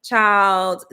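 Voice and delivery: usual speaking voice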